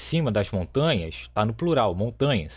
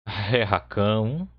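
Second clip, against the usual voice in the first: slow demo; much slower than usual. Shown as a demonstration. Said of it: "Então, Rakan".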